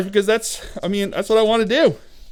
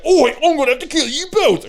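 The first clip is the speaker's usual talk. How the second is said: higher, put-on gleeful ork menace; 8 semitones higher than usual. Shown as a demonstration. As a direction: ham up the killer ork. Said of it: "it sounded more like".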